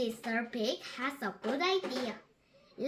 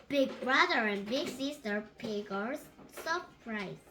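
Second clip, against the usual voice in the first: usual speaking voice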